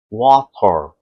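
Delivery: "water" with a clearly released t; water with true t. Shown as a demonstration. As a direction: pronounce "water" as "wa-tor", crisp t. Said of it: "se for um americano".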